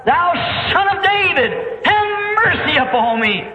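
high, desperate plea; crying out. Retold or imitated. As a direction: call out for mercy with all your might. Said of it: "And he cried".

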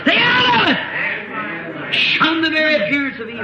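high, very slow shouted warning; preaching shout. Real, not acted. Preacher warning the congregation, very slow high shout. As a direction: thunder the warning at the flock.

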